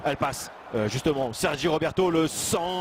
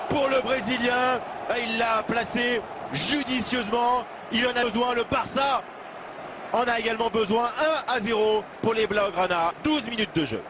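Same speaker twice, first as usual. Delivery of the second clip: excited sports commentary; 6 semitones higher than usual. Real, not acted. Play-by-play of a goal.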